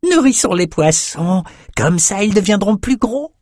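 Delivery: high, sing-song creature; gleeful imp voice. A narrator's reading. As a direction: chirp it with childish glee. Gleeful imp feeds fish.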